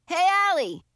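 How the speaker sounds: loud, high shout of a name; calling out. A narrator's reading. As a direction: call out to her across the room. Boy calling out across door.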